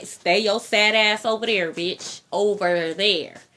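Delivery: dismissive. Pretend. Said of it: "you can say".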